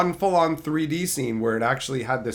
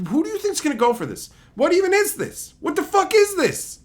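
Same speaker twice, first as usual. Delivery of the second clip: much higher, disbelieving cry; incredulous outburst; 3 dB louder than usual. Real, not acted. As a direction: throw up your hands in disbelief. Exasperated at the remake.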